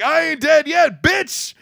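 high, loud triumphant yell; defiant yell. Retold or imitated. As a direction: shout it in his face like you just rose from the dead. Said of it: "And he's like".

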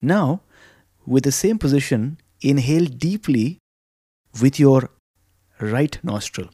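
soft, unhurried guided breathing voice; calm meditative tone. Acted. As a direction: guide them gently through each breath. Leads breathing exercise.

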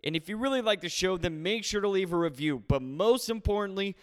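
podcast intro read. Acted.

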